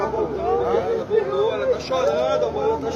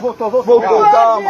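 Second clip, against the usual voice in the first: higher, louder cry of relief; loud relieved shout; 4 semitones higher, 9 dB louder than usual. Real, not acted. Relative shouting to the grandmother that the baby revived, relieved shout. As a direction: yell that she's back.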